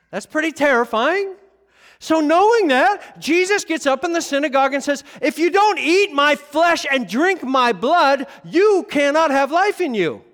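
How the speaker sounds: loud, high, driving pulpit voice; full-force preaching. Acted. Pastor preaching to his church at full force. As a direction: drive it home to the congregation.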